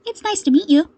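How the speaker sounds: bright, friendly greeting; lightly cheerful welcome. A narrator's reading. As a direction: sound glad to meet him. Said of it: "Midoriya agreed cheerfully".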